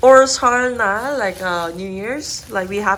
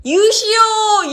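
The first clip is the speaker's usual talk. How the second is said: loud, high game call of 유시오; shouted call; 10 semitones higher, 6 dB louder than usual. Shown as a demonstration. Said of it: "You have to say 유시오".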